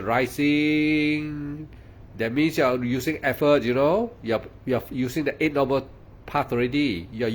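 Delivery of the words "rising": slow, drawn-out meditation noting of "rising". Shown as a demonstration. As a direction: stretch the rising out slowly, savouring "rising". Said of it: "When you say".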